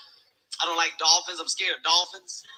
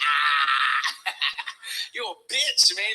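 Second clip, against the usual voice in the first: laughing; 4 semitones lower, much slower than usual. Retold or imitated. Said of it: "I'm like".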